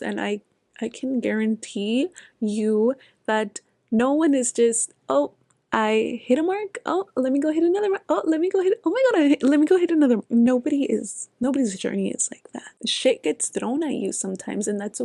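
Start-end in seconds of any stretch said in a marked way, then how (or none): 5.1-10.2 higher-pitched, rushed mock excitement; mock excited overachiever. Mocking the idea that progress is linear, mock excited. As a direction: rattle it off giddily, rising in pitch as you go. Mocks flawless run of hitting marks.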